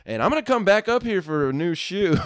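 high-pitched mock parting threat; indignant customer. Customer walking out, warning the clerk, indignant. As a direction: toss it back as an affronted customer.

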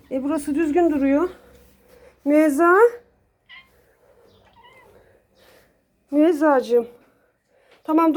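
sevgiyle, yüksek sesle kediye seslen; kediye tatlı ses. Real, not acted. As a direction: anne şefkatiyle kedi sevme. Kediyle konuşma. (kediye seslenen sahibi, şefkatli ve tiz)

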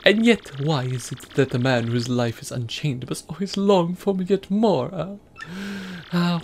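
put-on lightly accented theatrical merchant; hammed-up foreign trader; Italian-ish accent. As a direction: muse dramatically in a faint Italian accent. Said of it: "Flavio is like a Italian-y".

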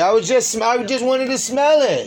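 high, loud, exasperated protest; exasperated shouting. Real, not acted. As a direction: yell back defensively. Argument continues.

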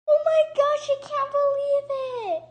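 high, loud cry of disbelief; high, loud, overjoyed; excited disbelief. Real, not acted. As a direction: burst out in amazement. Disbelief at lucky hatch.

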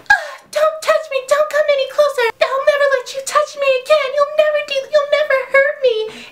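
high, fast mock outrage; mock hysterical girl. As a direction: shriek it like a melodramatic heroine. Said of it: "she, like, gets up".

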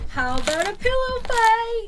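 high, rising party cheer; excited. Acted. Girl proposing a game at a slumber party, excited. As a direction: pitch it up with building excitement.

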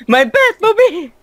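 high, slow, gleeful exclaim; excited. Real, not acted. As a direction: proudly claim it. Joking about a nonexistent car.

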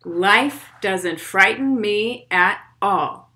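put-on steady rhythmic poem read for kids. Reads poem aloud to children.

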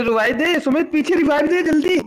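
high pleading yell; urgent shout. Real, not acted. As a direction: hurry the teammate up. Urging teammate to revive.

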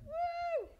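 very high, drawn-out woo; celebratory whoop. Real, not acted. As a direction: whoop with sudden joy. Celebrating the healing.

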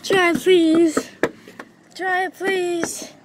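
high pleading voice; desperate begging. Real, not acted. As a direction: beg him desperately. Begging when caught.

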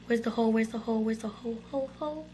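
repeated under the breath, fading away; anxious muttering. Real, not acted. Searching for the hole in a hurry.

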